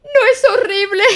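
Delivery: very high, loud frightened question; ask, clutching a friend's hand; scared whisper-cry. A narrator's reading. Piglet to Christopher Robin, terrified question.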